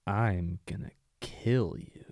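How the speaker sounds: slow, soft, lightly voiced villain threat; a hint of a calm, creepy villain; chill creepy villain. Shown as a demonstration. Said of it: "the chill, creepy villain".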